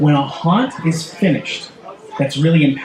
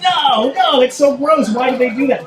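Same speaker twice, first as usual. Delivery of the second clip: much higher, squealing no no in disgust; mock squeamish squeal at a gross toilet; grossed-out whine; 10 semitones higher than usual. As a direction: squeamish high protest about the spraying toilet. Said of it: "and I'm always like".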